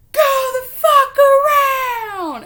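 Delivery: full-voiced, furious mock screech; retold road-rage yell. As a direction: reenact screaming at the drivers behind. Retold yelling at honking drivers.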